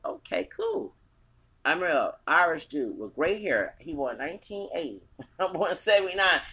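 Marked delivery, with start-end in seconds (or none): 0.0-1.0 imitating British approval. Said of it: "they say".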